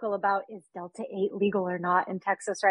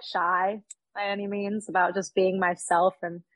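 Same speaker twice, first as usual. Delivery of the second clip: ordinary delivery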